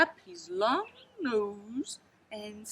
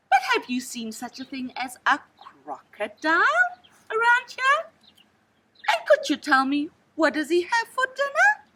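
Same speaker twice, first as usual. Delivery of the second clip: put-on eager child asking; 6 semitones higher, 4 dB louder than usual. A narrator's reading. Child asks snake, high at end.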